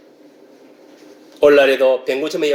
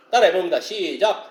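normal talking voice

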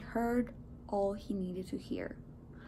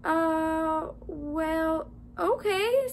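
drawn-out, higher-pitched reluctant okay; hesitate, then give in higher; hesitant agreement; 6 semitones higher, 3 dB louder, much slower than usual. A narrator's reading. Man unsure about lending phone, hesitant.

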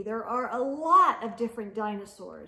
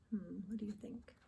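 fast soft unvoiced whisper; quick whispered aside; 2 semitones lower, 12 dB quieter, faster than usual. Real, not acted. Aside question to young listeners.

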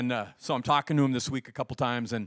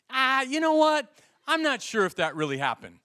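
doubtful sceptic; 10 semitones higher, 5 dB louder than usual. Pretend. Imagined sceptic doubting the story.

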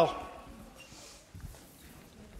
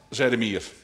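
ordinary delivery, nothing marked

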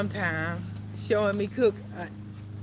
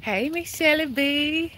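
calling out; 6 semitones higher, slower than usual. Real, not acted. Greeting neighbor across street.

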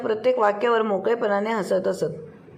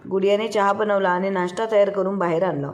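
usual speaking voice

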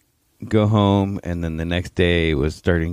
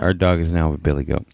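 ordinary delivery

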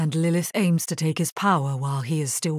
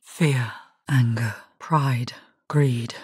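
low, slow whispered litany; eerie low whisper; 7 semitones lower, slower than usual. Acted. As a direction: breathe each word as a dark temptation. Demonic whispers in the mind.

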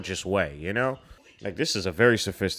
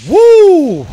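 very high, loud whoop; shocked whoop; 19 dB louder than usual. Real, not acted. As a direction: let out a long piercing "woo". Reacting to shocking film moment.

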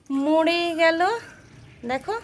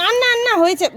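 urgent call to stop; 11 semitones higher, 13 dB louder, faster than usual. Real, not acted. Child pours too much water. (বাচ্চাকে থামাতে জোরে উঁচু গলায় চিৎকার)

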